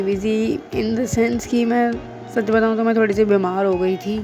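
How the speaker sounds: usual speaking voice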